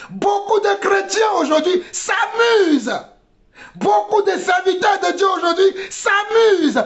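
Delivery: high, shouted exhortation; fiery preacher's cry; impassioned preaching. Real, not acted. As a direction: admonish the congregation at full force. Preaches at full force.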